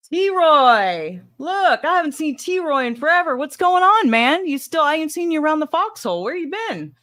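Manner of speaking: high, delighted hello to a long-lost regular; excited greeting. Real, not acted. Greeting a viewer not seen in forever, excited and high. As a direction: call out in happy surprise, pitch jumping up.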